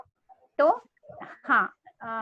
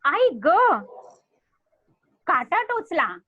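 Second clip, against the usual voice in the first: lively read-aloud of a bird's cry, a bit louder; storybook character voice; 4 semitones higher, 5 dB louder, slower than usual. Shown as a demonstration. Teacher reads a bird's line to children, lively and louder. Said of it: "यहाँ लिखा है".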